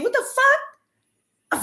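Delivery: drawn-out squeaky what the fuck; slow high squeal. Retold or imitated. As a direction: stretch it out, very high and baffled. Drawn-out disbelief.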